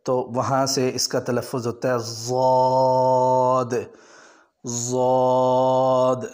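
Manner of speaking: ضاد from the side of the tongue, held long; correct dhaad. Shown as a demonstration. Teacher gives the tajweed ضاد. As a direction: recite ضاد slowly, not as دواد or غواد. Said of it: "تجوید والوں نے اس کا تلفظ یہ لکھا ہے".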